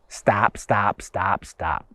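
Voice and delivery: low, hushed, repeated command; inner voice. Acted. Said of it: "What does the voice say?".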